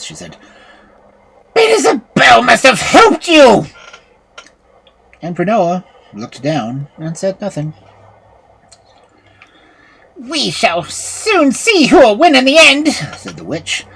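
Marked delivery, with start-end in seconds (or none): 1.5-3.7 high, loud furious witch shout; witch's fury. A narrator's reading. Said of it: "her fury in her voice". 10.2-12.9 high, loud menacing witch; witch's anger. A narrator's reading. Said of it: "her eyes blazed with anger".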